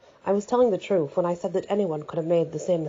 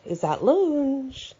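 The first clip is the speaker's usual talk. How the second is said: eager, higher; 8 semitones higher, much slower than usual. A narrator's reading. Eager question, slow high.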